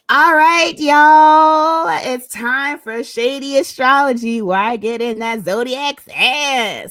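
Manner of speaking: loud, sing-song show host opener; segment intro. Acted. Host kicking off the astrology segment, loud announcer voice. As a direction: announce it to the audience, big and bright.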